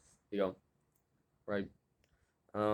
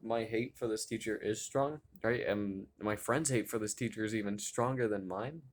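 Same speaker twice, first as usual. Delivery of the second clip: ordinary talk, nothing marked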